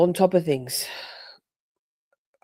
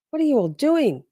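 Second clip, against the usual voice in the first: ordinary delivery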